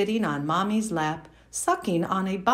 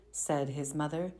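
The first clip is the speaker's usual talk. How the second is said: usual speaking voice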